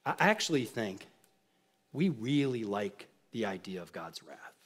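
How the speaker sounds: a near-whispered admission; hushed confession. Real, not acted. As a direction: drop right down and confide it. Quiet confession of a claim.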